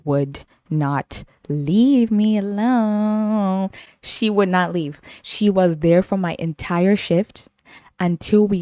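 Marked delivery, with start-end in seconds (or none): 0.0-3.7 very slow, each word stretched; dragged-out emphasis. Pretend. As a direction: stretch "would not leave me alone" out. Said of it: "I literally mean".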